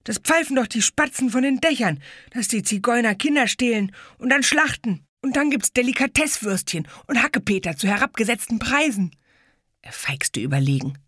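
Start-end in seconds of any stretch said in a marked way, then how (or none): 0.0-9.2 know-it-all bully. Acted. Boy self-important.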